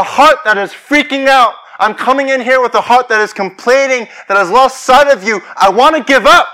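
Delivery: fervent declaration with punched words; impassioned preaching. Real, not acted. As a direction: preach it out to the whole congregation. Preaching at full force.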